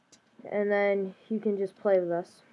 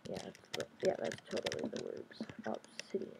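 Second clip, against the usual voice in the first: whispered word; 10 semitones lower, 12 dB quieter than usual. Real, not acted. Player naming a block to himself, whispered. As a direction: hush out Obsidian like a secret. Sneaking in the game.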